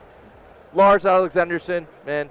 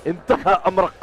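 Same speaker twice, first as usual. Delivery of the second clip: put-on Arabic accent. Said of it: "I could definitely pull off some Arabic".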